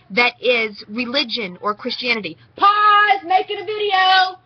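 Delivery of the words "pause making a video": loud call out. Real, not acted. Breaks off rant, calls out.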